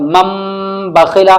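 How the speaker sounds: "मम" with a long nasal hum on the final m; ghunna demonstration. Shown as a demonstration. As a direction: hold the m of "मम", then say "बखीला". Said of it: "गुन्ने के साथ". ("मम" का मीम गुन्ने के साथ खींचकर)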